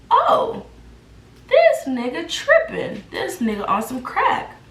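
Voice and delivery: high-pitched exclamation of disbelief; incredulous outrage. Retold or imitated. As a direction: start high in shock, come down into exasperation. Shocked reaction in retold quarrel.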